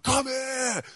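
high chasing yell; angry shout. Retold or imitated. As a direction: call after them like a furious soldier. Angry soldier chasing them.

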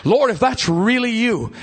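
high, pleading call across water; Peter's imitated cry. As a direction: call out high, as a disciple to his master. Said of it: "they screamed out to the Lord".

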